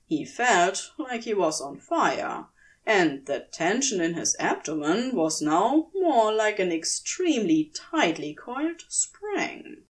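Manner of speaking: ordinary delivery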